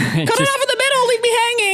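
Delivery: playful squeaky ribbing; mock teasing. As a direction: tease the storyteller in a squeaky mock voice. Teasing friend about rambling story.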